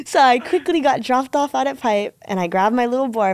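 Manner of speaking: normal talking voice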